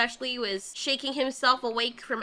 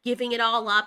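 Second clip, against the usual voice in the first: ordinary talk, nothing marked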